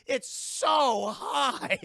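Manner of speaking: drawn-out shout, voice jumping high; slow, high, outraged yell; exasperated shout. Real, not acted. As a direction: yell it out in disbelief. Outraged at the casualty count.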